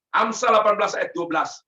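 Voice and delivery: ordinary talk, nothing marked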